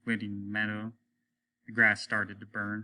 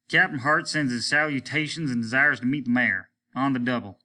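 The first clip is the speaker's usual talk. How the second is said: louder, higher brisk commands; captain snapping back to duty; sharp captain; 4 semitones higher, 6 dB louder than usual. Acted. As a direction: bark the orders. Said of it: "tightened back into his sharpness".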